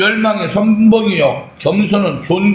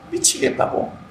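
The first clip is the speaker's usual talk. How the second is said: whispered despair; 10 semitones higher, 7 dB quieter than usual. Retold or imitated. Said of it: "막 울어요".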